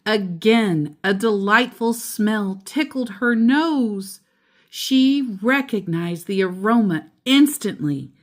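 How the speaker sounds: ordinary delivery, nothing marked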